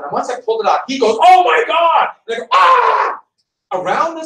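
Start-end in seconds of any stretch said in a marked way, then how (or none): 1.2-2.1 shocked shout. Retold or imitated. Roommate finds worms on spoon. 2.5-3.2 startled scream. Retold or imitated. Teacher screams at worms.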